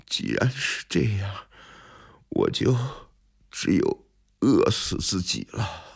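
feeble and halting, with long pauses; weak starving scholar, barely getting words out; slow, weary. A narrator's reading. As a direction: resign yourself in a faint, drained voice. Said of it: "慢慢地说".

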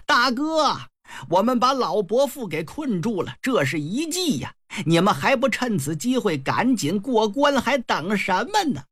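high, urgent call to a comrade. Acted. Huang Ming urges his sworn brother through the pass, calling out. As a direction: call over the fight and hurry them along. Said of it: "黄明啊喊上了".

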